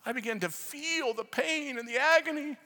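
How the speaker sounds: high, drawn-out and pained; anguished recounting. Real, not acted. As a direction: tell it slowly, heavy with agony. Preacher overcome recounting his intercession.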